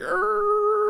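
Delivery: high, strained malfunctioning robot. Retold or imitated. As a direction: squeal like a breaking bot. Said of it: "that was your robot impression".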